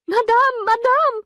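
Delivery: high, loud calling out; cried out. A narrator's reading. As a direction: burst in crying out to her mistress. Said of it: "cried Félicité".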